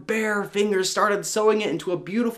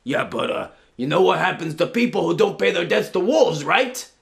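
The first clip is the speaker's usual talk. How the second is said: louder, veiled threat from a shady wolf; 5 dB louder than usual. Acted. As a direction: lean in like a loan shark. Wolf threatens about debts.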